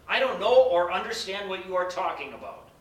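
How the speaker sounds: Peter's loud, defensive denial; forceful denial. Acted. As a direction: deny it outright, raising the voice. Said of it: "He replied".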